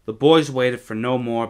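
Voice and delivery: plain reading voice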